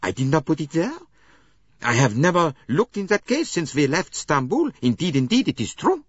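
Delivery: loud, high, rising protest of innocence; the maid frantically denies hiding the uniform; pleading denial. A narrator's reading. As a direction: insist desperately you did not do it. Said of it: "looked from one to another pleadingly".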